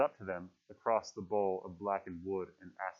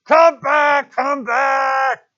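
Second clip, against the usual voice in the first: very high, very loud frantic scream; 18 dB louder, much slower than usual. Acted. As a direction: scream at the ship, losing control. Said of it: "His voice rose insanely".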